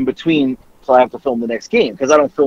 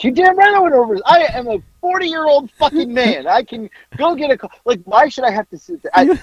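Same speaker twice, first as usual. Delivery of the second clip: indignant rant; 10 semitones higher than usual. Real, not acted. Indignant rant about coffee.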